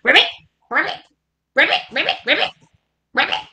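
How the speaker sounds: short ribbits, each flicking upward; playful frog noises for children. Acted. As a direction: croak like a little frog. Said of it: "Let's hear our lovely frog noises".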